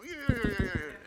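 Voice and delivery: high, loud whooping; imitated exuberant father. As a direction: whoop like dad hoisting a kid up. Imitates father lifting teenager up.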